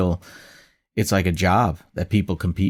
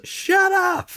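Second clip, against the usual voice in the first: much higher, snapped mock rudeness; mock rude stranger. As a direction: imitate a passerby telling you to shut up. Stranger yelling at street musician.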